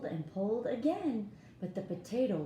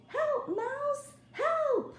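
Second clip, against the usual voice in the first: very high, loud cry for help; cat calling mouse; 5 dB louder, much slower than usual. Acted. Cat calling the mouse for help, very high loud call. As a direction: squeal for the mouse to come help. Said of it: "here's the cat saying".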